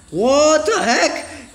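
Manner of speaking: slow laughing disbelief. Real, not acted. Laughing at crazy speed.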